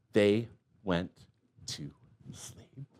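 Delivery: fading to a hushed whisper; sleepy whisper trailing off. Acted. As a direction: soften down as if lulling to sleep. Bedtime ending of story.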